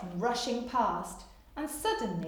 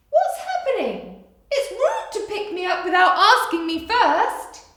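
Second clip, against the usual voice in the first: high, loud, offended cat thoughts; a cat outraged at being picked up; high indignant Mog; 9 semitones higher, 10 dB louder than usual. Acted. Mog's indignant thoughts voiced.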